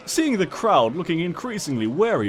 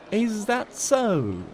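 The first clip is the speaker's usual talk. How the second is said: slow, drawn-out amused question; amused teacher; 8 semitones higher, much slower than usual. A narrator's reading. Cheerilee teasing Twilight gently, slow and amused. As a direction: stretch it out, amused.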